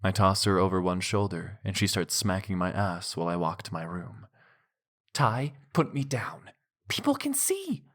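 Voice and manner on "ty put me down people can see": high, squealing woman's voice; playful protest. Acted. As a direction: squeal it while being carried. Female character protesting.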